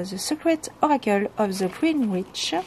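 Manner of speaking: English title in a French accent; self-mocked French accent in English. Real, not acted. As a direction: read the English words with a careful French accent. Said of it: "avec mon superbe ag-, accent, euh, anglais".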